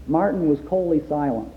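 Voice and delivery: ordinary delivery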